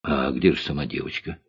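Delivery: low, soft puzzled question; surprised. A narrator's reading. Boy asks cook about doll's owner, mildly surprised. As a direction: ask quietly, mildly surprised. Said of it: "удивился Гарик".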